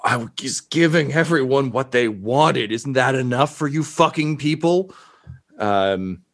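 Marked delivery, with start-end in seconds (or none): 0.0-4.9 aggrieved bad guy, voice climbing; exasperated villain. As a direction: plead indignantly in a put-on villain voice. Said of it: "he, he just says".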